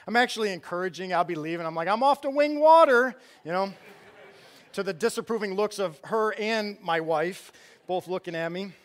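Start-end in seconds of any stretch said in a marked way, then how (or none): 1.9-3.1 playful announcement. Retold or imitated. Dad teasingly says child's word.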